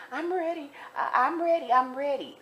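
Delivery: high-pitched mock whimpering insistence; imitated tearful voice. Armed frightened inmate insisting she is ready, imitated high whine. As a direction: put on her high, tearful voice. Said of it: "and she was crying".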